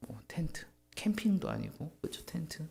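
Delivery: hushed disbelief, breathy and small; scoffing whisper. Acted. Preacher mocks God's tent as a campsite, whispered.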